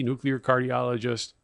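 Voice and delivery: ordinary delivery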